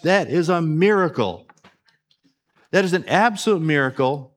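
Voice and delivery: loud, high-pitched sermon delivery; full-force preaching. Real, not acted.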